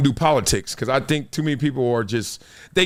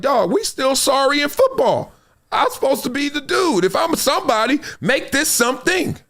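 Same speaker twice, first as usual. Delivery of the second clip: high-pitched, exasperated complaint; acted protest; 10 semitones higher than usual. Player protesting his team is still sorry, high-pitched exasperation. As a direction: plead the case high, in mock exasperation.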